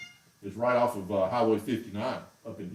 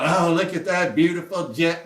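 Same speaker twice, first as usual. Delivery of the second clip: louder, higher mock delight; mock gushing admiration; 5 semitones higher, 6 dB louder than usual. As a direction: gush with a big smile, imitating him. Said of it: "he's got this big smile".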